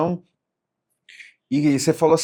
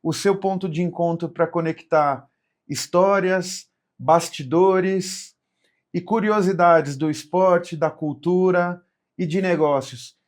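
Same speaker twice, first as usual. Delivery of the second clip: podcast host intro; 5 semitones higher, 3 dB louder, slower than usual. Acted. Podcast opening host read.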